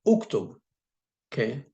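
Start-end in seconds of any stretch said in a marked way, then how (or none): 0.1-0.5 uktub with the u sounded high and clear; u clearly heard. Shown as a demonstration. Teacher making the opening u of uktub heard. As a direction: bring out the u of uktub. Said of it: "notice you can hear me say u".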